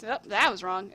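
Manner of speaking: plain reading voice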